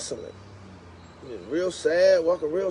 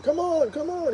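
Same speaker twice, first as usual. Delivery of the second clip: calling the dog; 12 semitones higher than usual. Retold or imitated. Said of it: "neighbors across the street screaming".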